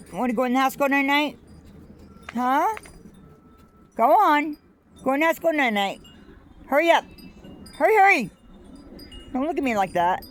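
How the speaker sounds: talking to the dog. Real, not acted. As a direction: coax the sleepy dog inside in a high sing-song. Coaxing tired dog into house.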